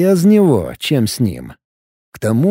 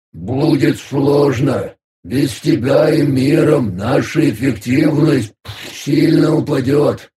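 slow bubbling creature voice; gurgling alien voice; slower than usual. Acted. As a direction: gurgle the words out slowly. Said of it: "пробулькал мастер".

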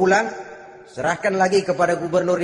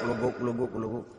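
soft bubbling-pot noise, whispered low; boiling water bubbling; 8 semitones lower, 10 dB quieter than usual. Retold or imitated. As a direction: mimic a pot gurgling quietly. Imitating boiling water sound. (tiruan bunyi air mendidih pelan)

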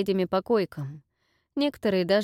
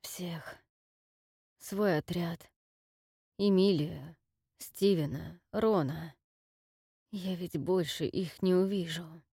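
quiet despair; 4 dB quieter, slower than usual. Acted. Wounded man grieving lost squad.

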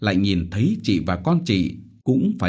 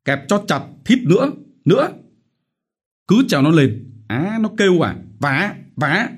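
rapid barked orders, higher and louder; relentless shouting; 11 semitones higher, 4 dB louder than usual. Acted. Lý trưởng ordering guards to torture, relentless shouting. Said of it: "Lý trưởng vẫn dồn dập thét".

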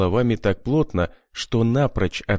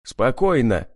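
usual speaking voice

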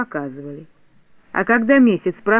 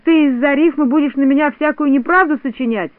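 indignant, raised higher pitch; boiling over; 6 semitones higher, 4 dB louder than usual. A narrator's reading. Znayka challenges Neznayka over untrue rhymes, boiling over. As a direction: flare up in indignation. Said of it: "вскипел Знайка".

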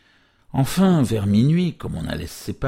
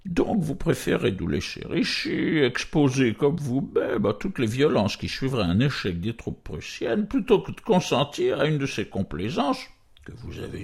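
higher-pitched voice of a disdainful, paternal count; put-on aristocrat's condescension, pitched up; condescending count; 5 semitones higher than usual. A narrator's reading. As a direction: talk down to her like a familiar paternal superior. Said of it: "ton familier, paternel, un peu dédaigneux".

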